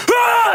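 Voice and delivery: short, high-pitched acted yelp; sudden high "ah" of alarm; pained cry. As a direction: cry out as the blow lands. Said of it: "So she goes".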